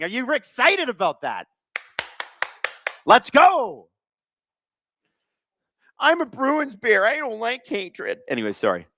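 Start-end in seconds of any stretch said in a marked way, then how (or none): 3.1-3.9 hype cheer. Acted. Hype cheer during physical game. 6.0-8.2 slow, higher put-on bear mascot voice; Bruins bear voice. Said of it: "I gotta stop doing that".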